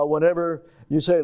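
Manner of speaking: ordinary talk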